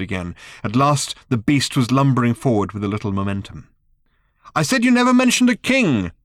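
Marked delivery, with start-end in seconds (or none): 4.5-6.1 high, loud repeated shout. A narrator's reading. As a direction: shout it again, exasperated. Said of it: "shouted Arthur again".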